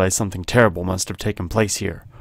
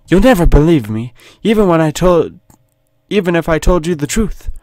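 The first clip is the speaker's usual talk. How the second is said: high-pitched British damsel, protesting; put-on high British accent; British woman; 6 semitones higher, 4 dB louder than usual. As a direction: protest as a captive British lady. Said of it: "I don't know why she's British".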